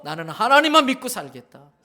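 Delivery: loud, firmly preached vow; resolute declaration. Acted. Preacher voicing a believer's resolve to live by God, resolute and loud. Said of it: "결단하고 살아야 됩니다".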